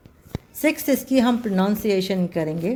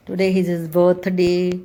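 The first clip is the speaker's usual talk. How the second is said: slow and clear, birthday stressed; slow example; 5 dB louder, much slower than usual. Shown as a demonstration. Teacher reading a birth example to students, slowly. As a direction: read it out slowly for learners. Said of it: "birth यानी कि जन्म".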